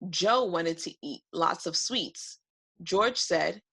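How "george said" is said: plain reading voice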